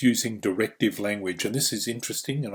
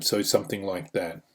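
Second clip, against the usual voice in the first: ordinary delivery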